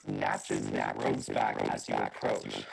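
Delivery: low, slow robotic monotone. A narrator's reading. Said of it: "You turn into a robot for a second".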